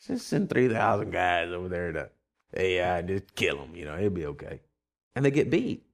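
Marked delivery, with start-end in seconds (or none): 0.0-4.6 low, offhand overconfidence; Joshua. Acted. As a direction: toss off the order as no big deal. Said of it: "he says".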